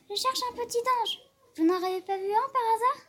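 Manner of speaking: put-on high, quick little-boy voice; boy's voice. A narrator's reading. Boy asking the gloomy portrait, high and eager. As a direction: sound like a small curious boy.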